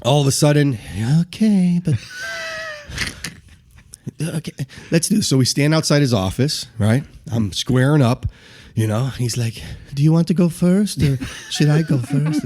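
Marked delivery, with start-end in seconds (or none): 1.2-5.3 higher, reluctant, passive agreement; put-on gentle, hesitant voice; Joe Haas. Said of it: "very quiet and passive in the way he talks". 9.9-12.4 higher, polite, passive imitation; put-on gentle voice, pitched up; Joe Haas. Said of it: "very quiet and passive in the way he talks".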